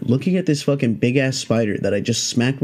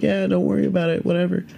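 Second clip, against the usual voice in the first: mock-casual reply, pitched up; casual brush-off; 7 semitones higher, faster than usual. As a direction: imitate a high, offhand send-off. Friends answer the handler.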